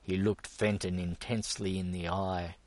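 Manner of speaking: usual speaking voice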